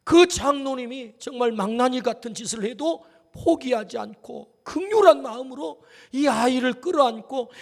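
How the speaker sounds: normal talking voice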